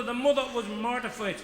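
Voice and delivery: ordinary talk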